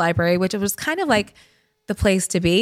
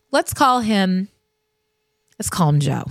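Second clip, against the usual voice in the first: normal talking voice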